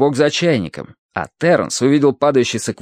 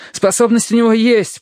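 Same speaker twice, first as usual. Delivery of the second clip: high, urgent explaining; agitated explanation; 11 semitones higher, 3 dB louder than usual. A narrator's reading. Terence explains while stripping for the rescue, agitated. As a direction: explain in raised, hurried agitation.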